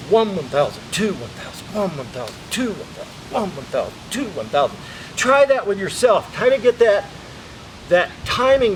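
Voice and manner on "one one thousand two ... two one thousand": steady one-second count. Shown as a demonstration. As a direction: count each second evenly. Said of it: "let's get that timing down".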